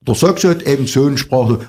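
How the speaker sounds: Saxon accent impression. Shown as a demonstration. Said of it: "das hat was mit Sprachmelodie zu tun".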